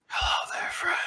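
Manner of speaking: eerie unvoiced whisper from within; sinister whisper. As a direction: breathe it like a hidden evil voice. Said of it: "A deep, sinister voice".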